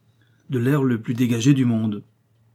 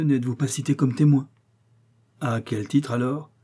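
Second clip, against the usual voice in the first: ordinary delivery, nothing marked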